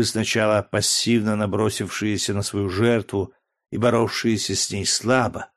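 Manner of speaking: ordinary delivery, nothing marked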